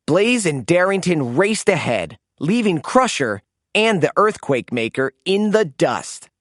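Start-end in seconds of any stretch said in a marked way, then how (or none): none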